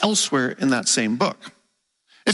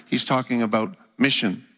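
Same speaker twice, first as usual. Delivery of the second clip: ordinary delivery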